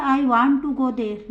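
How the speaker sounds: slow, every word separate: I want to go there; slow full form. Shown as a demonstration. Teacher says the uncontracted form slowly. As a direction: spell out "want to" as two slow words. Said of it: "बिना contraction के कैसे बोलते हैं?".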